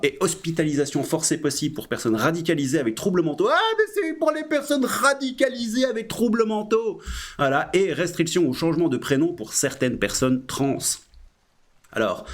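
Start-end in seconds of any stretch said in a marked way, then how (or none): 3.5-7.0 mock surprise.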